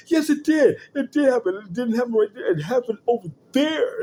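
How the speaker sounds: put-on high, loud whiny kid voice; whiny kid insisting. Son insisting to his sister in a high whiny voice. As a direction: whine it like a kid caught lying. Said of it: "He's like".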